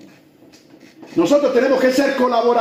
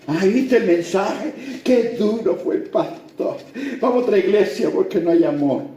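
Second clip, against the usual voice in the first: gossiping churchgoer; 3 semitones lower, 3 dB quieter than usual. Retold or imitated. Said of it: "se atreven a criticar al pastor".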